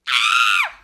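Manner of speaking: loud startled shriek; shocked scream. Retold or imitated. Friend reacting to a movie reveal, loud scream. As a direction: scream in horror.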